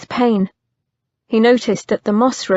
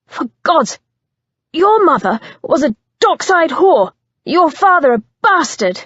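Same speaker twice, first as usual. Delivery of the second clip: high, loud shouted slur; shouted insult; 7 semitones higher, 4 dB louder than usual. Acted. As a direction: hurl the insult.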